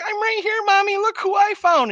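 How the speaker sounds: put-on a small girl sweetly proud of her find; creepy little girl. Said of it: "and she's like".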